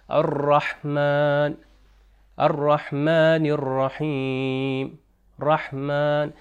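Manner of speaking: الرحمن with a held, rolled r and long final vowel; slow recitation model, letting air through on the r of الرحمن; articulated raa. Shown as a demonstration. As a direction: draw out الرحمن الرحیم, stressing each raa. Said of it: "کہ را جب اوپر لگتا ہے".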